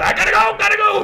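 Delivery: very high, loud comic brush-off; Larry David. Retold or imitated. Busy accountant rushing off phone, high loud Larry David impression. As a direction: yelp it in a frantic impression. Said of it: "just like in Larry David's voice".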